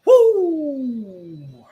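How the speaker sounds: high, loud woo; excited whoop. Real, not acted. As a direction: cheer wildly. Cheering the game start.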